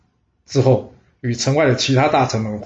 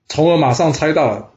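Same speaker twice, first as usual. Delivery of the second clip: plain reading voice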